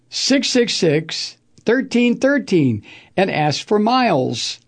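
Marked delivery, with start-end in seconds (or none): 0.1-2.8 six six six spelt out slowly; slow number read. Real, not acted. Host repeats the sponsor's number slowly for listeners. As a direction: spell out the number slowly, digit by digit.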